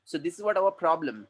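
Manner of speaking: normal talking voice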